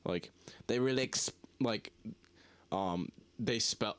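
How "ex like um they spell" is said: ordinary talk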